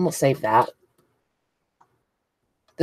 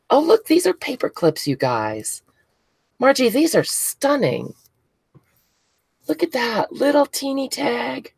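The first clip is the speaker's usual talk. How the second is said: thrilled gushing; 11 semitones higher than usual. Real, not acted. Unpacking handmade gifts on camera.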